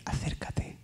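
very soft whispered order; whispered summons. Retold or imitated. Dying father whispering to his son to come closer. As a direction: breathe the word out barely audible. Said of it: "me dice".

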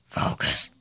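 soft unvoiced whisper. Shown as a demonstration. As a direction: breathe the word, no voice. Repeats word, second time whispered.

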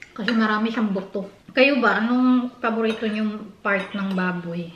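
slight chewing at start; casual mealtime talk; talking between bites. Real, not acted. Seen 1: Spoons into her mouth just before; picks at pork while talking.

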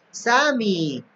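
a hint of storybook surprise; Ricky's greeting. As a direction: greet a friend with a falling call. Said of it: "says Ricky".

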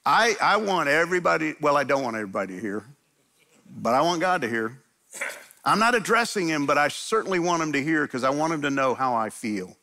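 usual speaking voice

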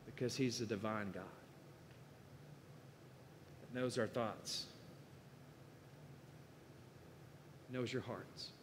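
quiet, weighty sermon close; hushed solemn. Real, not acted. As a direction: let it fall to a reverent hush. Solemn point about God.

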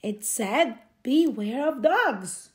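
ordinary delivery

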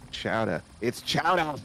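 chowder said chowdah, Boston style; mock the Boston way of saying chowder; Boston accent. Retelling the Simpsons chowder argument in a Boston accent. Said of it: "And he was like".

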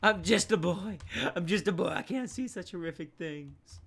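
mock-innocent high plea, starting loud; hammed-up wail of a boy who can't look; horrified boy act.

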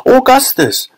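very high calling cry; high-pitched mother's call; Missus Gloop. A narrator's reading. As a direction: call out sharply to your son. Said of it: "called out Missus Gloop".

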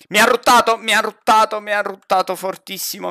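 loud, high, frantic repeating; alarmed outburst. Real, not acted. Player tells teammate the ghost burped at them, alarmed. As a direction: blurt it out over and over, starting at a yell and easing off.

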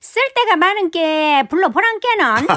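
high, lilting Korean dialect line; regional dialect. Acted. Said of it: "사투리가 한마디 합니다".

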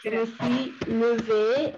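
slow, each word set apart for the class; teacher modelling the correct form slowly; slow model pronunciation. Shown as a demonstration. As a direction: spell out Je me suis levé slowly, word by word. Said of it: "ahí como somos una mujer también".

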